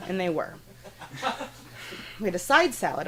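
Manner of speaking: normal talking voice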